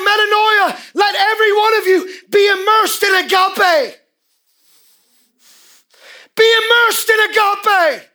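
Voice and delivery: high, loud preaching cry; full-force preaching. Acted. Preacher exhorts the congregation at full force, shouting high. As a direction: drive it home to the rafters.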